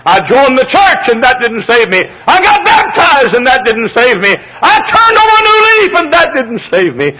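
high, mounting pulpit cry; impassioned sermon shout, easing on each refrain; full-force preaching. Acted.

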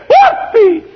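high, loud whoop of joy; hollered whoop. Retold or imitated. Congregant jumps up during preaching, hollered joyful whoop. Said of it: "he hollered out".

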